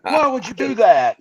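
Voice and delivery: put-on high, loud child's wail; mock upset daughter; upset little girl. Father imitating his daughter upset over the candy, high and loud.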